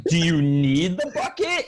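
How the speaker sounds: slowed-down, careful question; as to small child. Retold or imitated. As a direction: ask it slowly, as if to a five-year-old. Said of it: "it's like speaking to a five-year-old".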